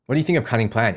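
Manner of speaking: ordinary talk, nothing marked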